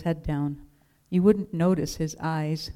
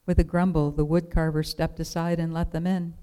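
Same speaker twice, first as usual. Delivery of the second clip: ordinary delivery, nothing marked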